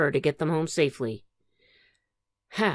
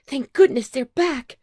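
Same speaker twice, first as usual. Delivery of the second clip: higher, louder relieved cry; 9 semitones higher, 5 dB louder than usual. Acted. Aunt Patsy relieved the children are back. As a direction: let the relief pour out. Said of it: "cried Aunt Patsy".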